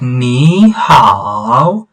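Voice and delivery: 'Ni hao' dragged out with exaggerated dips and rises; prolonged tones. Shown as a demonstration. Teacher shows how not to say it, overdrawn 'Ni hao'. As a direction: stretch both words of 'Ni hao', swooping through each full tone. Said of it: "we do not say".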